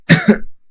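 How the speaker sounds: short burst of coughs; acted coughing. Dave coming round after blacking out, acted coughs.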